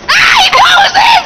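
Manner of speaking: high delighted shout; excited shout. Real, not acted. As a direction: call it out, thrilled. Excited at spotting friend.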